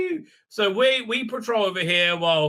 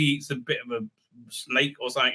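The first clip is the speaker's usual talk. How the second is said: ordinary talk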